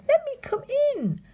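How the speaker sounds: very high mock-sweet pig; sing-song it like a sly pig; wheedling pig. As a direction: falsetto wheedle through the phone.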